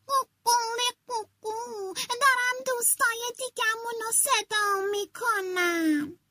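proud little rooster explaining. Acted. Rooster telling the fox he is calling friends, high rooster voice. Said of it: "خروسه گفت".

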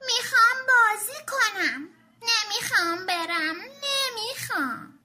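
high, loud tearful whine; crying shout. Acted. Tommy refusing to leave Sara's house, tearful shout. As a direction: howl it like a kid who won't leave. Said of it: "داد زد و گفت".

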